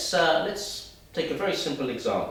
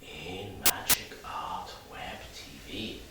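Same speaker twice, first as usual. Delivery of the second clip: slow, sensual whisper; hushed, drawn-out, sultry tease; sensuality; 4 semitones lower, 5 dB quieter, slower than usual. Shown as a demonstration. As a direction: whisper it seductively, rising at the end. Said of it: "What about sensuality?".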